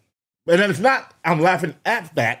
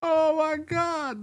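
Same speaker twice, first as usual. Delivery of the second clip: stunned; 12 semitones higher, 7 dB quieter, much slower than usual. Real, not acted. Reacting stunned to clip.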